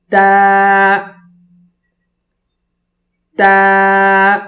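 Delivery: تا with tongue to the palate, thin long vowel; thin tajweed articulation. Shown as a demonstration. Teacher models for students, thin drawn-out تا. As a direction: hold a light, thin تا on one level note. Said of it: "اور باریک آواز ہو".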